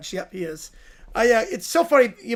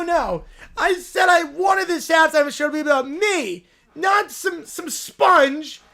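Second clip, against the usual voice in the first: higher, louder spoiled-brat yelling; whiny tantrum shout; 4 semitones higher, 4 dB louder than usual. Acted. Lil Ron Bear refusing the SpongeBob tribute, whiny shouting. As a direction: wail like a kid denied his show.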